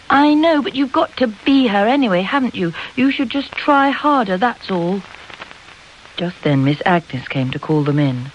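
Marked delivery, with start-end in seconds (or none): 0.1-5.0 put-on high, urgent girlish pleading; insistent. A narrator's reading. Charlotte urging Emily to try harder, insistent. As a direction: press the point in a raised pitch. Girl urges her companion.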